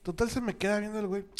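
ordinary delivery

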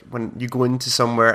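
normal talking voice

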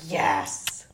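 breathless voiceless yes; mock ecstatic yes. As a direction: gasp it out like craving food. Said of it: "and you're like".

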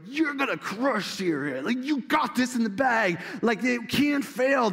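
high, singsong hype from yes-men prophets; mock cheerleading. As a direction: cheer the king on, hammed-up. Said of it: "your cheerleading squad over here".

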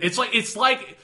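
ordinary delivery

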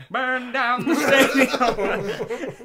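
shouting. Pretend. Announcer riffing on ape at track.

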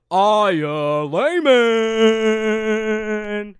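loud, drawn-out ring-announcer call; announcer intro. Pretend. Host introduces his co-host as titular prize fighter, announcer shout. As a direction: belt the name out, stretching the last syllable.

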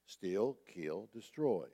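ordinary talk

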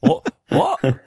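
high, bright little what; toddler what. Retold or imitated. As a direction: say it like a curious toddler. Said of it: "imitating the toddler's what".

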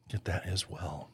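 muttered afterthought repeat; soft echo. Acted. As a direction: drop it to an aside echo. Echoed repeat, much softer.

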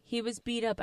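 ordinary talk

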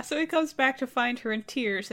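usual speaking voice

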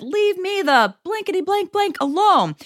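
half-acted outburst at a stalker, pitch way up; retold yell. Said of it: "I yelled a bunch of obscenities".

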